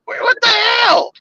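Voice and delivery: high, exasperated disbelief, rising to a shout; incredulous yell. Retold or imitated. A driver ranting about an old wooden bridge, high exasperated yell. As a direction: shout it up high in mock outrage.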